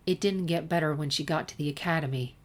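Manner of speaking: usual speaking voice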